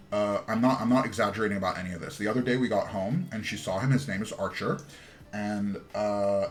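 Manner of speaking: ordinary delivery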